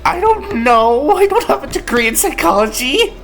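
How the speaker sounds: flustered contestant. Acted. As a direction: play the stumped player pleading ignorance.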